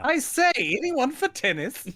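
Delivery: high, lilting mock-English toff; hammed-up upper-class English accent; mock posh English. Quotes Salad Days parody.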